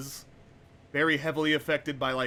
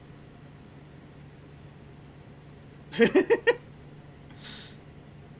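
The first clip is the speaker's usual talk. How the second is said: high-pitched burst of real laughter. Real, not acted. Streamer amused while on about treacherous ice, high laugh. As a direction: break into a high, breathy laugh.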